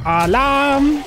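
high, loud shout of Alarm; mock alarm shout. Gamer spots a monster and yells a loud mock alarm. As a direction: raise the alarm loudly.

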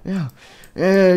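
usual speaking voice

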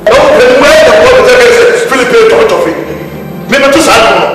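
high, loud angry yelling; furious shout. Acted. Shouting at S0 during the family quarrel, furious. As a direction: yell it at him full force. Heated argument between relatives.

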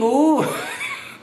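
very high, helpless laugh; laughter. Real, not acted. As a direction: burst out laughing after the imitation. Laughs at own imitation. (甲高く吹き出す笑い)

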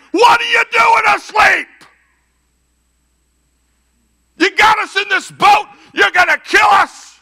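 high, full-out desperate shouting; loud, demonstrative panic. Acted. Disciples waking Jesus in the storm, loud and frantic. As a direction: yell it like you're about to drown. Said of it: "They are so loud and demonstrative".

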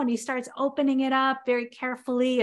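normal talking voice

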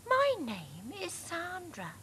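very high, slow flirty voice; Sandra the fox. A narrator's reading. Fox introducing herself to Dad, high slow voice. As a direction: flutter it coquettishly. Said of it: "said the fox".

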